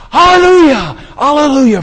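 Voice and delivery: two high, booming shouts; thundering voice. Acted. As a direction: cry it out at full power. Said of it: "it's like thundering, and it's saying".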